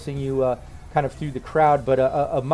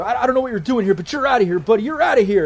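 loud, higher bouncer voice throwing you out; gruff security guard; 8 semitones higher, 5 dB louder, faster than usual. Acted. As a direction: shove him off like a bored bouncer. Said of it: "he just says".

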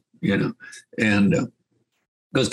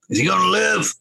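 hollering; 10 semitones higher than usual. Retold or imitated. Buddy hollering from the chutes.

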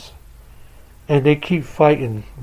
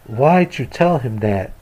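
ordinary delivery, nothing marked

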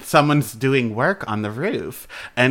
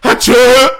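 loud fake sneeze, high and sharp; 10 semitones higher, 12 dB louder than usual. Shown as a demonstration. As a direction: belt out a big sneeze. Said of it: "am I pronouncing it right?".

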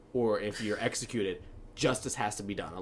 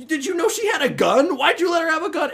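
much higher, louder accusing questions; upset Race; 8 dB louder than usual. Retold or imitated. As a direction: demand answers, high and loud. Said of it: "Race is like".

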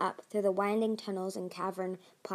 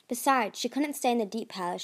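usual speaking voice

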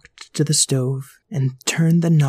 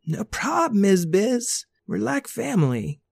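put-on laid-back babysitter; 7 semitones higher than usual. Voicing Randall the babysitter.

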